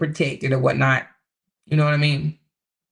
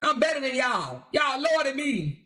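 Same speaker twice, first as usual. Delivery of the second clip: higher, gloating mock voice; mock Kevin bragging to his critics, higher and gloating; mocking boastful Kevin; 9 semitones higher than usual. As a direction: lord it over everyone like a show-off. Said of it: "He said".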